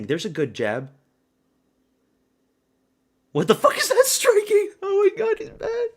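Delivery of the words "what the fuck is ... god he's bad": high, loud disbelieving cry; shocked outburst. Real, not acted. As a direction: cry out in real shock at the fight. Live reaction, shocked at strike.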